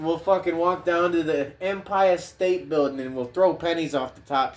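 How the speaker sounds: usual speaking voice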